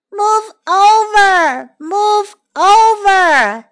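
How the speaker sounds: sing-out "let me aboard" call. Acted. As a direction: call slowly across the water, stretching the vowels. Another voice calling from water.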